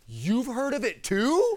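high, slow, loud wide-eyed question; naive amazement. Retold or imitated. Young Zane amazed his friends know the verse. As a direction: sound innocently amazed.

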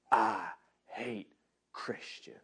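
imitating a cold, menacing whisper. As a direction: hiss it low and threatening, almost no voice. Punk confronts him in circle.

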